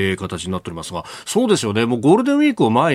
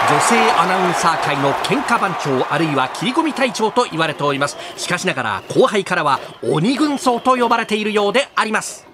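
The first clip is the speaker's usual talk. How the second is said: sports commentator; 8 semitones higher than usual. Acted. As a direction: hype play-by-play voice.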